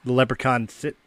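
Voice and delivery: ordinary talk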